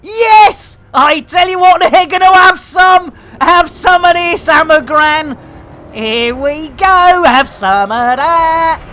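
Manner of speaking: high, loud gleeful taunting; battle cry. Real, not acted. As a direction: roar it while blasting the enemy. Opening fire in battle.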